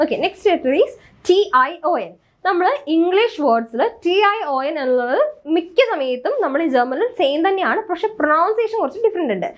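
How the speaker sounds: usual speaking voice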